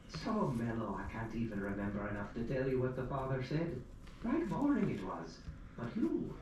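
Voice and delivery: put-on an old Irish widow cackling gossip. Old widow teasing the narrator about church, lilting high. Widow's chatty reply in her voice.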